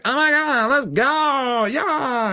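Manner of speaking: very high, squealed mock cheer; excited little kid. Speaker as his younger self starting Nintendo, thrilled squeal. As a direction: ham up a kid's wild delight. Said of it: "Little, little [name] with this".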